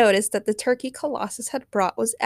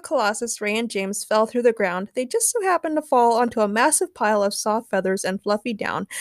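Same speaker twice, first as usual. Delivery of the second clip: plain reading voice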